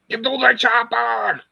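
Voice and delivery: high, loud shouted Austrian impression; Arnold Schwarzenegger. Streamer quoting an action film, shouted Arnold impression. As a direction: bellow it like an Austrian action hero. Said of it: "trying to sound like Arnold Schwarzenegger".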